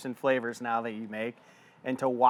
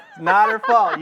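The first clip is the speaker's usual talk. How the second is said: high, loud laughing line; 13 semitones higher, 9 dB louder than usual. Real, not acted. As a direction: laugh it out, much higher. Teasing disclaimer amid laughter.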